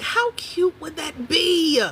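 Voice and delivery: high, slow and dreamy; gushing daydream. Retold or imitated. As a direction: stretch it out, swooning at the idea. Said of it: "I'm like".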